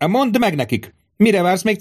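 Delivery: very high, exasperated order; impatient outburst. A narrator's reading. Tribune prods the stalling centurion, impatient outburst. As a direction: push hard for obedience.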